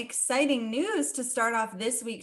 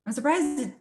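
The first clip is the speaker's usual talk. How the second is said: ordinary talk, nothing marked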